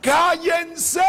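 high, loud yell; shouting shut up. Retold or imitated. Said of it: "Yo les gritaba".

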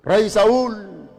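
David calling out to the king. Retold or imitated. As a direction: call up high and loud. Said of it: "le gritó".

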